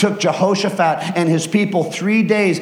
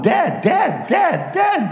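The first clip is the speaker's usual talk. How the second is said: second dead pair shouted much higher; counting the dead; 9 semitones higher, much slower than usual. Acted. Soldier counting bodies, rising to a high shout.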